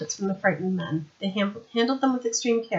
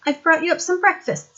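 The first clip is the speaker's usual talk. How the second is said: put-on higher, louder young woman, brisk; young woman's voice; 10 semitones higher, 6 dB louder than usual. Sandra explaining to Vimes how she got there, higher young woman voice. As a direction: play her bright and eager.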